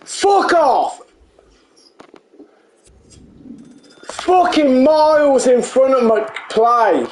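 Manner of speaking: angry swearing rant; swearing tirade. Real, not acted. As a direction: spit out the curses. Player swearing at game.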